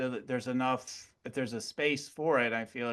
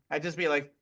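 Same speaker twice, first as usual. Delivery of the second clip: usual speaking voice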